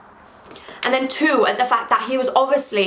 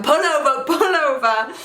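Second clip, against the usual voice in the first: higher-pitched, drawn-out mock urging; imitated urgent plea; 6 semitones higher than usual. James to crying driver, imitated urgent plea. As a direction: act out a passenger insisting you stop.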